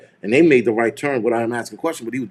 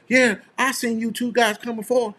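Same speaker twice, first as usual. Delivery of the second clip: put-on Mississippi accent; 9 semitones higher, 3 dB quieter than usual. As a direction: imitate a young Mississippi cop, pitched way up. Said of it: "That's my best Mississippi accent".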